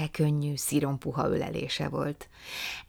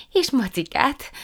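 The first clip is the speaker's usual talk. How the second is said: Prue's voice; 9 semitones higher, 7 dB louder than usual. A narrator's reading. Prue agreeing.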